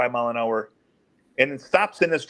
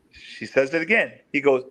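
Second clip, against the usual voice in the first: ordinary talk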